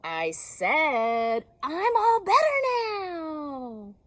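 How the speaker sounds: loud, high, slow shout over the crying. Acted. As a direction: yell to be heard, half laughing. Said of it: "shouted Bird".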